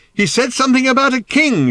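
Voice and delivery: high, loud holler; shouted reply. A narrator's reading. Arthur answers Ford while holding on, shouting. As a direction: yell back over the din. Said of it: "shouted Arthur in return".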